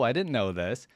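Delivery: ordinary talk, nothing marked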